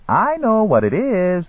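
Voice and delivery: high, slow lilting eunuch voice; sing-song. A narrator's reading. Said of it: "said in a sing-song voice".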